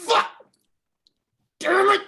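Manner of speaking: high, loud burst of cursing; acted furious swearing; angry outburst. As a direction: explode in frustration. Rick acted cursing in temper.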